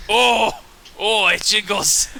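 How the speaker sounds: loud, high, drawn-out delight; delighted wonder. Acted. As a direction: marvel like an easily thrilled creature. Creature delighted by a jingling stick.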